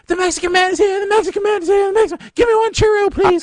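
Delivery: put-on high excited kids calling out; sing-song like children chasing a truck; cheery ice cream seller. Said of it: "Be like the kids going down the street".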